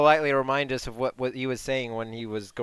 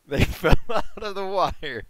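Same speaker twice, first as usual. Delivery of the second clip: laughing; 7 semitones higher, 7 dB louder than usual. Real, not acted. Laughing echo of a joke.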